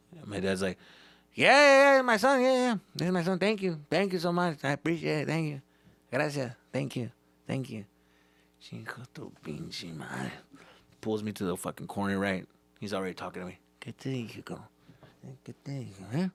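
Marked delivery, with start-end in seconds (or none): 1.3-7.8 high, loud overly grateful start fading down; fake-polite dad. Dad fake-nice to security guard. 8.6-10.3 low, soft, slow seething curse; muttered curse. Retold or imitated. Dad cursing after guard leaves. 13.8-16.3 hushed scolding. Retold or imitated. Dad scolding in corner.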